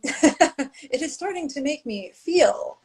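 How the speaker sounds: slow, flat robotic monotone. Retold or imitated. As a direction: imitate a robot, each word even. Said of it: "Says like a robot".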